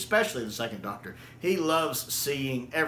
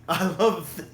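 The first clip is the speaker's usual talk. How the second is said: laughing; 6 semitones higher than usual. Real, not acted.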